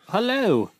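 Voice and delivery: high British hello; mock-British greeting, falling in pitch; British accent. Co-host greeting a supporter from the UK, mock British accent. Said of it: "We love the accent".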